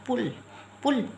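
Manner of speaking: पुल with a short, quick u. Shown as a demonstration. Teacher showing the small u sound, quick short u. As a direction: let पुल out briefly. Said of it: "हम जल्दी से हमारी आवाज़ को बाहर निकाल रहे हैं".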